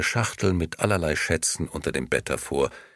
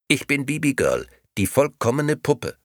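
high, squawky doll repeating itself; a tinny talking doll on repeat; 8 semitones higher, 3 dB louder than usual. A narrator's reading. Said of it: "quäkte die Puppe".

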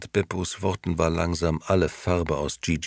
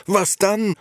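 higher, louder desperate cry; shouting; 5 dB louder than usual. Acted. Old Beppo shouting at Gigi about Momo, louder. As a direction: yell it at him in panic. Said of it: "schrie Beppo".

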